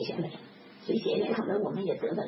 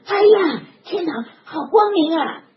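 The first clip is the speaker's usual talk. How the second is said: put-on high, loud child's cry of wonder; 哎呀 cried high and loud, then slowly savoured; amazed cry; 6 semitones higher, 10 dB louder, slower than usual. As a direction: exclaim in awe like a delighted child. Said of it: "大声喊了".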